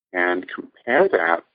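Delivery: ordinary talk, nothing marked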